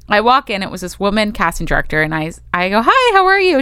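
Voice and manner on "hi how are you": high, perky hello; friendly greeting. Retold or imitated. Walking in to meet the casting director, friendly. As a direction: greet them in a lifted, eager pitch.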